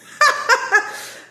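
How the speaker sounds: squealing high laugh; high burst of laughter. Real, not acted. Reader bursting out laughing at herself, high squeal. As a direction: let out a loud high giggle.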